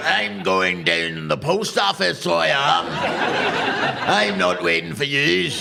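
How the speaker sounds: broad Northern Irish local, slow and stubborn; defiant Northern Irish local, hammed-up. Stubborn local mocked in accent.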